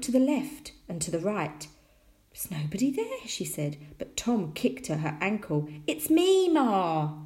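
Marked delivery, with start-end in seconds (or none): 2.3-3.3 higher, puzzled murmur to oneself; puzzled mother. A narrator's reading. Mother puzzled at empty doorstep. 5.9-7.1 put-on high, loud boy's cry, dropping at the end; calling out. A narrator's reading. Tom calls up to mother.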